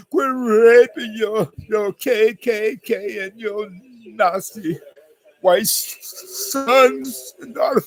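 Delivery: high, slow wailing plea; mock tearful. Pleading with fathers and stepdads, mock tearful. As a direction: plead through exaggerated fake sobs. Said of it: "It makes me wanna cry".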